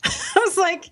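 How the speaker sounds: a short high laugh over the words. Real, not acted. Laughing at her own meltdown while telling her friend. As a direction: laugh at yourself as you say it.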